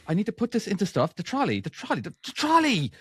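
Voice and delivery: high-pitched, escalating frustration; exasperated insistence. Retold or imitated. Parent asking baffled kids for a trolley, increasingly exasperated. Repeating word to uncomprehending kids.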